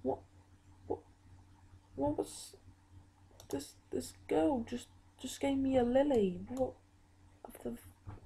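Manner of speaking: high, startled disbelief; surprised. Real, not acted. Surprised by an in-game gift.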